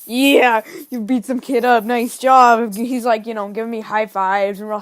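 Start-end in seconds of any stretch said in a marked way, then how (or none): 0.1-2.7 loud, hyped-up friend congratulating; mock celebratory hype; retold cheering. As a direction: cheer him on, punching the first and last words.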